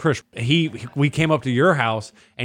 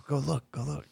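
whispered, secretive prompt; very soft imitation of a friend; hushed urging; 13 dB quieter than usual. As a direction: urge them on under your breath. Hushed urging in retold scene.

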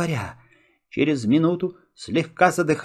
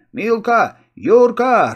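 high, loud happy calling out; joyful call; 5 semitones higher, 5 dB louder, slower than usual. A narrator's reading. Boy greeting friends in the dark corner, joyful shout. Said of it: "радостно окликнул я".

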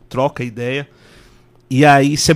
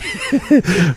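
burst of laughter; 12 semitones higher than usual. Real, not acted. Host laughs mid-sentence.

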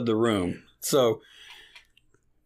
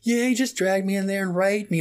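nonchalant; 6 semitones higher than usual. Retold or imitated.

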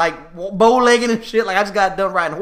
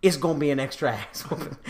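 stern dad; 5 semitones lower, 6 dB quieter than usual. Retold or imitated. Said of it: "he's like".

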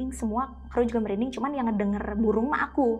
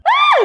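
sharp high yelp of pain; retold scream of pain; 16 dB louder than usual. Woman bitten by a monkey, sharp pained yelp. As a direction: cry out as the monkey bites. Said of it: "gitu ya, kayak".

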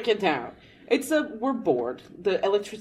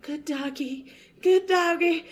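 high, coaxing doggy talk; calm the angry dog, nervously; soothing a dog; 10 semitones higher than usual. Retold or imitated. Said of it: "I'm like".

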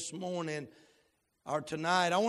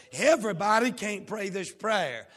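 ordinary talk

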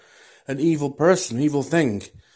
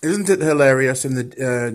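ordinary talk

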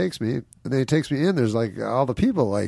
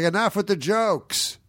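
high, drawn-out heckle; imitated heckler; 11 semitones higher, slower than usual. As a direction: call out from the crowd, mock impatient. Heckler in the crowd, retold.

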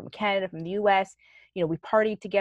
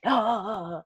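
mock upset whine; 4 semitones higher than usual. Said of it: "he's upset".

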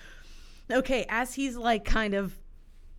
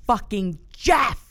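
slow, louder, higher exclamation building to the name; outraged outburst; 5 semitones higher, 7 dB louder, slower than usual. Acted. Jamie realizing who the lover is, acted outrage. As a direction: hammer the name in mock outrage.

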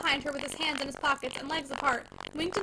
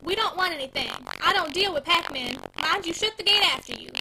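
louder, curt and cold; loud dismissal; 3 semitones higher, 6 dB louder than usual. Acted. Wife loudly dismisses the packman at the gate, curt.

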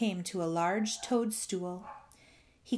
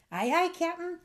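high-pitched little crew reply; toadlet crew; 10 semitones higher, 4 dB louder than usual. A narrator's reading. Toadlets agreeing with their captain, high chorus-like reply. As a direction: answer up high like little toads.

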